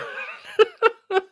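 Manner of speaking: very high cartoonish clown cackle; shrieking goofy clown laugh. Clown laugh after joke.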